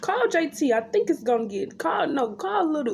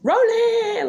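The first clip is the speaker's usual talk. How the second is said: high sing-song wail; 13 semitones higher, 7 dB louder than usual. Retold or imitated.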